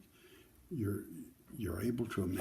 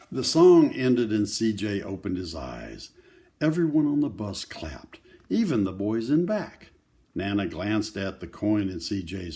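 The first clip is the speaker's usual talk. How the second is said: lively picture-book read-aloud; reading to children; 7 dB louder than usual. Acted. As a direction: tell the story to a circle of kids.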